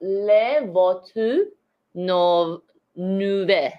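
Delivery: slow, syllable by syllable; staccato. Real, not acted. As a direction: sound it out one syllable at a time. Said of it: "Just go syllable by syllable".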